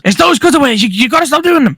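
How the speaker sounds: high, insistent cartoon voice; Stewie impression. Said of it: "And then Stewie goes".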